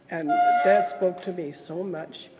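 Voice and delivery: ordinary delivery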